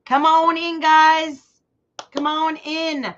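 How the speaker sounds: high, loud welcoming call; excited welcome. Real, not acted. Host welcoming viewers into her live, loud and high. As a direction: call them in with big energy.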